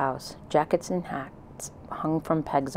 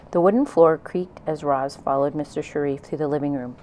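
plain reading voice

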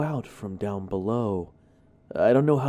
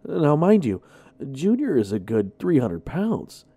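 usual speaking voice

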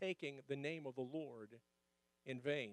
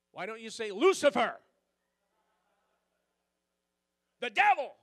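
mock outburst; 4 semitones higher, 11 dB louder than usual. Preacher's mock retort.

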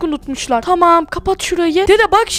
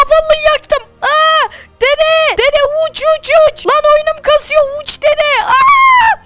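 frantic excited yell; 7 semitones higher, slower than usual. Acted. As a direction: scream at grandpa to take off as it blows. Explosion, urging grandpa to fly off.

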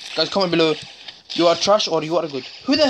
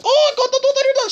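very high, loud squealing plea; squeaky mock begging; mock panicked enemy; 4 dB louder than usual. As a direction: imitate the scared guy begging. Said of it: "he was like".

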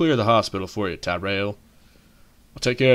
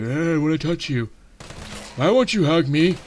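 higher, pleading mock zombie; needy zombie voice; 7 semitones higher than usual. Player voicing a wandering zombie, higher pleading mock whine. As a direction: whine like a lonely zombie who wants a hug. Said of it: "He's like".

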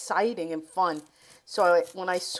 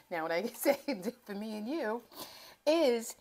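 softer take, "Now what I can say" broken by real laughs; laughing through it; 4 semitones higher, 3 dB quieter than usual. Real, not acted. Host repeats her rhyme to viewers, giggling through it. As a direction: laugh at your own poetry. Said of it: "now I'm getting a little poetic here".

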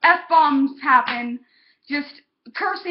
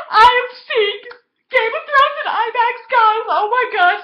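higher and louder, bursting with excitement; excited; 8 semitones higher, 4 dB louder than usual. Real, not acted. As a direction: gush about seeing the movie. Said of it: "I'm so freaking excited".

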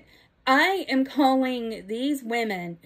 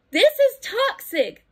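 "This is toxic." thrown up high, stress on "is"; impassioned; 10 semitones higher, 3 dB louder than usual. Real, not acted. Telling girls and ladies, passionately, "This is toxic.". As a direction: hit "is" in "This is toxic." with rising outrage. Heated repeat of same line.

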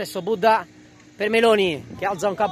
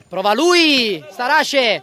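louder, higher, building to the goal; rising excitement; 5 semitones higher, 7 dB louder, much slower than usual. Real, not acted. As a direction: build toward the shot. (crescendo concitato)

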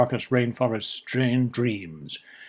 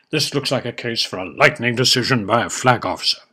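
usual speaking voice

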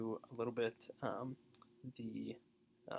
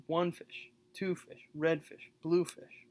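ONE, TWO, RED, BLUE punched higher, each "fish" weaker; trochaic stress; 5 semitones higher, 5 dB louder, slower than usual. Shown as a demonstration. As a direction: hit the first word of each "fish" pair in a chanting beat. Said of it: "You can hear the emphasis".

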